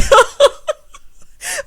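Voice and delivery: high, loud peal of laughter. Real, not acted. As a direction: burst out laughing hard. Cracks up at own story.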